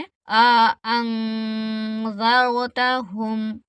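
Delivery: slow, the नून of अं hidden in the nose; nasal ikhfa demo. Shown as a demonstration. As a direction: hum अं through the nose before ज़र, slowly. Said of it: "नाक में छुपा के पढ़ना".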